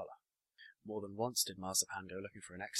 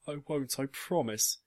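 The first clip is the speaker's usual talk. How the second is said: mild tearful cry, raised pitch; crying promise; 6 semitones higher, 7 dB louder, slower than usual. A narrator's reading. Father answering his upset daughter, mildly tearful. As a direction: promise it higher and louder, lightly acting tearful. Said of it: "he cried as well".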